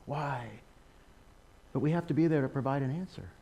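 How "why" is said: soft, quiet retold cry of why, why; a man questioning God, voiced quietly; hushed pleading. Retelling a former ISIS fighter's question, hushed. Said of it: "He's questioning".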